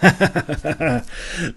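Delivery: a short light laugh, voiced apart from the narrator; carefree laugh. Warrior laughing back at the boatman as he leaves, carefree laugh.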